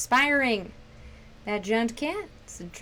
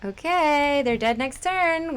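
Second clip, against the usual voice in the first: ordinary talk, nothing marked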